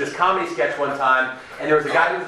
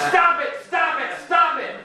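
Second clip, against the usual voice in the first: repeated high mock yell; 12 semitones higher than usual. Psychologist yelling at his anxious patient, high repeated mock yell. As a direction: keep barking it, half laughing. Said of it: "he just keeps yelling".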